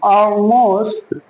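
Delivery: Almost as a long "aw-most", L hidden; drawn-out Almost with rounded first vowel; dark L demo. Shown as a demonstration. Teacher models it for learners, dark L demo. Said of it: "बल्कि हम क्या बोलेंगे".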